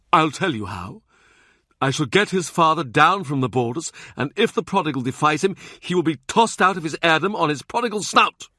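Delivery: high, heated Cardinal in full rant; scolding Cardinal rising to exasperation; angry Cardinal. Acted. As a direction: berate him, the voice climbing. Wolsey's tirade voiced by narrator.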